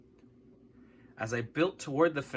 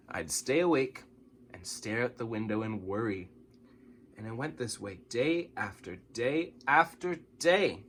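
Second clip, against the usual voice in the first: ordinary delivery, nothing marked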